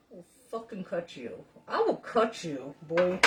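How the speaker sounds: a hint of a mock menacing threat. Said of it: "I was like".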